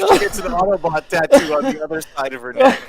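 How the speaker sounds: high, loud punchline through laughter; laughing hard. Real, not acted. Co-host topping the tattoo joke, cracking up. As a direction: pitch climbing as laughter takes over.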